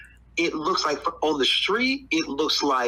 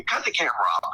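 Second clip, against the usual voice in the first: ordinary talk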